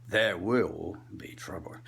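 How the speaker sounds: slow, low, hushed warning; Richard Burton. Acted. Comic as old actor warning of trouble, hushed menace. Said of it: "he wouldn't get that angry".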